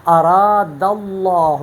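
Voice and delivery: slow اراد اللہ with the lam of اللہ full and heavy; اراد اللہ, its lam held heavy and full; heavy tafkheem. Shown as a demonstration. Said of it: "ہم اس کو اس طرح پڑھتے ہیں".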